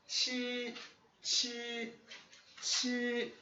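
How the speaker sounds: qi held high and level each time; first tone repeated. Shown as a demonstration. Teacher drilling the first tone on qi. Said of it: "é primeiro tom".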